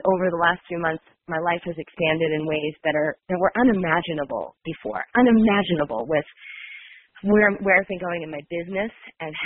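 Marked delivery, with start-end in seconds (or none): none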